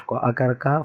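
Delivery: ordinary talk, nothing marked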